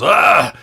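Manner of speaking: sudden loud, high "ah"; sharp high yelp of shock; jolted cry. Acted. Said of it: "he does the same thing where he kinda goes".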